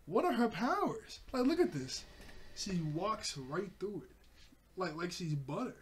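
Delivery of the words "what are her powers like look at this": baffled amazement. Retold or imitated. Baffled by her powers.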